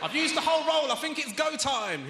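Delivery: hammed-up eager yell; mock eager shout. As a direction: yell it like a bragging idiot. Joke quote of dipstick partner.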